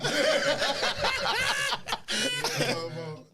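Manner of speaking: long shrieking burst of laughter; loud high laugh. Real, not acted.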